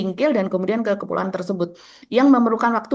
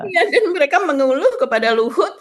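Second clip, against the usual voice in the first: laughing; 4 semitones higher than usual. Real, not acted. Laughs at guest's joke.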